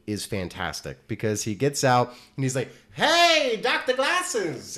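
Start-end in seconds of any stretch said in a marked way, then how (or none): 2.9-4.8 drawn-out high hail across the yard; calling out. Retold or imitated. Doctor calling out to house.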